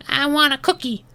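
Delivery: put-on very high baby-talk voice; toddler. Toddler answering the ant, very high small voice. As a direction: demand it like a tiny child. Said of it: "the human toddler says".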